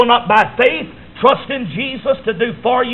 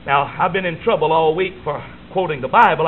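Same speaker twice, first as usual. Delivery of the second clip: ordinary delivery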